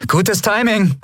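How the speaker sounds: high, happy shout of welcome; cheerful call. A narrator's reading. Endriel welcoming Telios, high cheerful call. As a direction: call it out gladly. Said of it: "rief sie".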